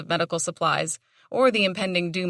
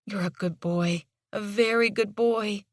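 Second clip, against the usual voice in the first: soothing praise for a hurt dog; 2 semitones higher than usual. A narrator's reading. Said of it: "soothing tone".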